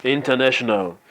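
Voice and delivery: slow, drawn-out slogan call with held words; slogan announcement. Acted. Presenter opening a training with the company motto, slow slogan announcement. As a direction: proclaim the motto, stretching each phrase. Recites organization slogan as opener.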